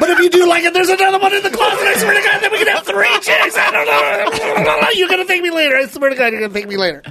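high mock coaxing, settling at the end; insistent friend. Imagining his friend pitching a third partner, high coaxing. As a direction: keep selling it high, then ease down. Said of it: "and he's like".

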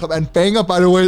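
sudden loud, high burst of enthusiasm; loud, eager interruption in praise of a song; excited outburst. Real, not acted. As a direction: jump in loudly to defend the track. Defending a song he loves.

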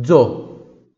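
Zo said dzo, loud and short; voiced dz model. Shown as a demonstration. Teacher models Zo loudly for students. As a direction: punch Zo out with a buzzing dz.

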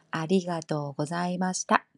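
ordinary talk

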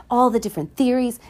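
ordinary talk, nothing marked

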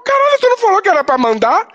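very high-pitched mock protest; indignant aunt. Aunt defending the sent photo, mock indignation. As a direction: squeal it in imitated self-defence. Aunt's startled defence, acted out.

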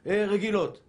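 usual speaking voice

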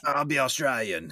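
a lightly put-on Australian accent; half-hearted Australian accent, hammed up; mock Australian accent. Podcaster mocking the actor's bad Australian accent. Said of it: "It is the worst Australian accent".

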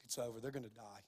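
low, very quiet imitation of a doctor; offhand pronouncement. Doctor telling a family their loved one will die, imitated low offhand pronouncement. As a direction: drop right down, deliver grim news casually. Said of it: "Just kinda like that".

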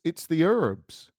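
HERBS drawn out as "urrbs", H silent; American herbs. Retold or imitated. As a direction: drag out herbs mockingly without the H. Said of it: "you say herbs de Provence".

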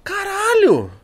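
exasperated outburst. Retold or imitated. Jealous outburst at handsome guy.

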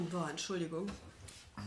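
hoarse from swollen tonsils. Real, not acted. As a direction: talk through a sore throat. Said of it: "leicht angeschlagen".

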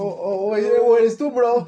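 loud, high laughing jab; loud teasing. Real, not acted. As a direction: tease him loudly, laughing.

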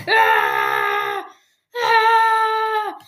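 long high loud mock scream; play scream. Podcaster under pretend gunfire, long screams.

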